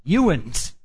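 "Ewans" said as one drawn word, "you-uns"; "Ewans" as old Ohio farm talk for you all; Ohio farm dialect. Shown as a demonstration. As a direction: say "Ewans" like rural Ohio folk. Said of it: "they said it like this".

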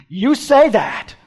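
loud, high, drawn-out declaration; emphatic retort. Retold or imitated. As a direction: hurl it back slowly and loudly. Emphatic re-voicing of Jesus' answer.

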